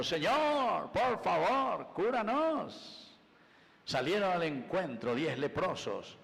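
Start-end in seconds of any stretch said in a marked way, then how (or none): 0.0-3.0 high, slow pleading cry; lepers crying out. Retold or imitated. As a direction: beg for healing from afar. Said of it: "porque ellos vienen gritando".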